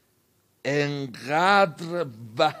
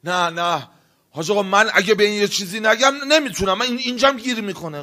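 worked-up insistence; 9 semitones higher, 5 dB louder, faster than usual. Acted. Angry man insists on retaliating.

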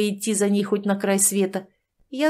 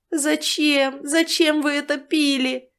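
despairing wail; 6 semitones higher, slower than usual. Acted. Old man clutches his head.

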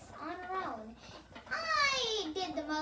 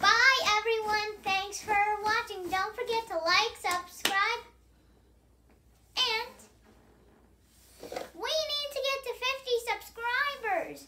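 louder, upbeat channel sign-off; loud, peppy vlog outro; youtuber outro; 4 semitones higher, 6 dB louder than usual. Real, not acted. Kid does vlog outro.

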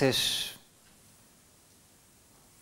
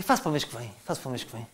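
very soft, low, fading promise; low procrastinating mumble; 8 semitones lower, 9 dB quieter, much faster than usual. Acted. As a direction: let it sink to a lazy murmur. Putting the chore off further. (murmúrio baixinho de quem adia)

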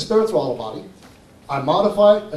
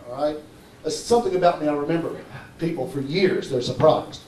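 ordinary talk, nothing marked

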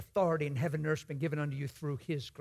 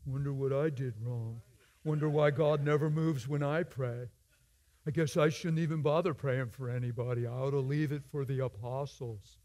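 mock discouraged mutter; 3 semitones lower than usual. Preacher mocking a believer who doubts in the car, mopey. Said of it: "you're not going".

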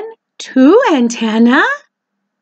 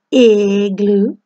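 usual speaking voice

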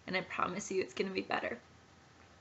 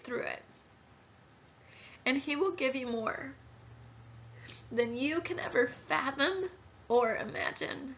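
higher, tearful voice close to breaking; emotional, near tears; 5 semitones higher than usual. Real, not acted. Pregnant mother telling viewers her story, tearful and higher. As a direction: talk through real tears, holding it together. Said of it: "I'm emotional because".

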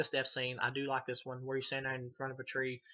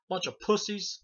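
higher, louder mocking quote; imitated paramedic jeer; 7 semitones higher, 5 dB louder, slower than usual. Paramedic mocks squeamish teenagers, high imitated jeer. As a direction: jeer in a put-on voice. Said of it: "he's like".

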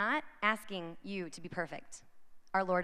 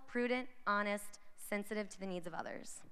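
usual speaking voice

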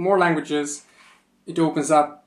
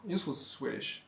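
whispered echo, much softer; 3 semitones higher, 14 dB quieter, faster than usual. Shown as a demonstration. Vlogger to viewers, a whispered repeat. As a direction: whisper it to stress it.

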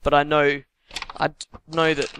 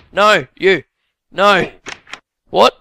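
loud, higher bursts of disbelief; real gamer outburst, much louder; exasperated shouting; 5 semitones higher, 10 dB louder, much slower than usual. Real, not acted. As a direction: yell at the game in frustration. Game goes wrong, player yells.

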